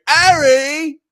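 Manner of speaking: loud, high hammed-up British yell; screaming. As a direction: scream the name through the house. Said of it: "I'm in my house screaming".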